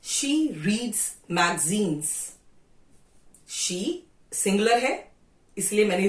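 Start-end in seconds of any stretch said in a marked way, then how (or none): 0.0-2.1 very slow, word by word; measured teacher's example sentence; slow dictation. Shown as a demonstration. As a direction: read the example like dictation to a class. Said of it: "हमारा first example है".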